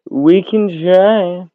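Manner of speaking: very slow, stretched we can try; drawn out. Real, not acted. Agreeing to try TikTok, very slowly drawn out. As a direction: stretch it out lazily.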